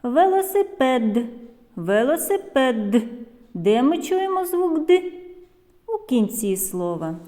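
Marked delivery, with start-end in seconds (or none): 0.0-3.0 велосипед articulated carefully with a fully released final hard д; careful model of велосипед, final д distinct; clearly sounded out. Shown as a demonstration. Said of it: "щоб добре було чути ці звуки".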